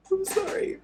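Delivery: a sobbed apology, barely held together; tearful sorry. Real, not acted. Apologizing to his audience while still crying.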